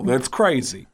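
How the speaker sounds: put-on Bronx comic voice at ordinary pitch; Tracy Morgan. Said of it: "sounds like Tracy Morgan".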